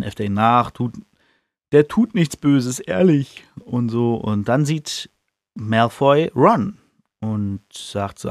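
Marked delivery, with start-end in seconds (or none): none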